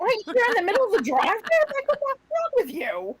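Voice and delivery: high-pitched, exasperated disbelief; incredulous outburst. Retold or imitated. Wife balks at husband's drive-thru request, incredulous outburst. Acts out her shocked reply to husband.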